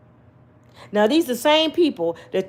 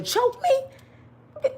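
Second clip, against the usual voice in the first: hammed-up sob on the line; comic fake crying; mock crying. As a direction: wail it like a wasted life. Lament over wasted years.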